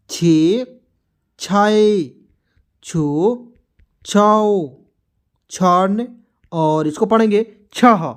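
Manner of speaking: one drawn-out syllable at a time, long pauses between; syllable-chart recitation. Shown as a demonstration. Hindi teacher reciting the barakhadi to learners, syllable-chart recitation. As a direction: recite each letter form deliberately for learners.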